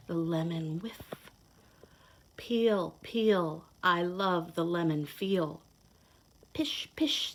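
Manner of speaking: lively storybook read-aloud; reading to children. Acted. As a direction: read it like a picture book to little kids. Reads picture book to children.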